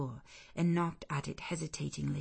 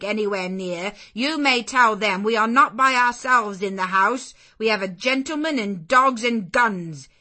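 loud, stern warning; suspicious threat; 2 semitones higher, 9 dB louder than usual. Acted. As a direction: threaten her with the men and dogs. Servant threatening the stranger.

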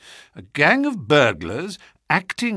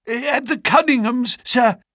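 high, urgent servant's voice, gasping out the news; a shaken butler bursting in; breathless butler; 12 semitones higher than usual. A narrator's reading. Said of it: "he gasped".

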